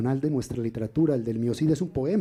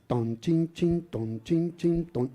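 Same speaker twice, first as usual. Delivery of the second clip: strong beat then two weak ones, ton chin chin; waltz beat; 4 semitones higher, much slower than usual. Shown as a demonstration. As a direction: tap out a waltz rhythm with the voice. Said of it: "un acento fuerte y dos débiles".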